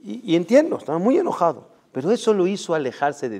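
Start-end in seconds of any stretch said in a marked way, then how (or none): none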